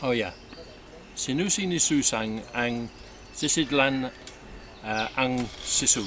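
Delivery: ordinary talk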